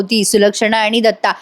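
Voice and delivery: ordinary talk, nothing marked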